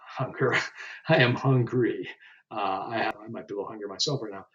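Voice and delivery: usual speaking voice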